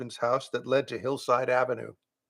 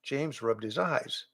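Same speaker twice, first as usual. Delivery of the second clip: plain reading voice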